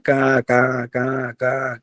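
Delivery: light cawing like a crow. Acted. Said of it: "કા કરતા આયા". (હળવું કાગડાનું કા કા)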